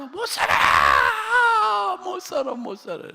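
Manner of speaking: slow, high, loud imitated sobbing; fading imitated cry; crying wail. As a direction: wail like the distraught deaconess. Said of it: "나한테 와서".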